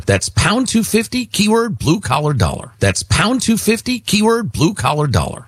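upbeat radio call to action; ad read. Acted. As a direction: call to action with high, punched numbers. Host reads sponsor ad.

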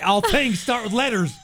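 high, laughing jab; mock your own blunder through laughter; laughing self-mockery. Host mocks own contest explanation, laughing and high-pitched.

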